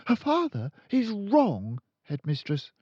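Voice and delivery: put-on high, polite young teacher; high pleading teacher; timid teacher. A narrator's reading. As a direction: stand firm though nervous. Miss Honey objects.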